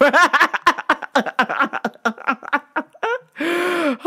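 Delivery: long high peal of laughter; helpless laughter. Real, not acted. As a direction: crack up at your own punchline. Cracking up at own joke.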